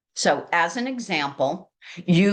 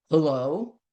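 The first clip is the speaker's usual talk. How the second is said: gruff hello. Shown as a demonstration. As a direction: answer like a grumpy caller. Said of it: "answers the phone a little bit gruff".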